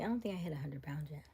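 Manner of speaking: soft retold whisper. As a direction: whisper the reply. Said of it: "I was like".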